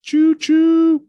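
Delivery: very high choo choo; train whistle. Pretend. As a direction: toot it like a train. Train noise imitation.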